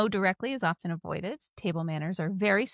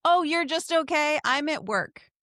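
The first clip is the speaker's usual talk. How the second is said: higher, louder incredulous comeback; mock indignant reply; 7 semitones higher, 4 dB louder than usual. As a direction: throw the okay back in disbelief. Mimics annoyed customer service reply.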